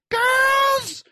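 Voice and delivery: high, loud summoning yell. Retold or imitated. Girl yelling for the housemates, loud and high. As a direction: call the whole pack in at full voice. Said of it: "She goes".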